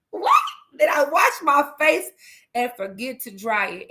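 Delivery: high incredulous echo, easing down at the end; retold disbelief. Mom echoes child's odd question, acted incredulity. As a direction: repeat the question back, amused and astonished.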